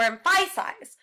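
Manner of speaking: "emphasize" with the middle syllable punched, em-FY-size; wrong-syllable stress. Shown as a demonstration. Teacher shows another weird misplacement, "emphasize" stressed in the middle. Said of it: "That, that would be weird".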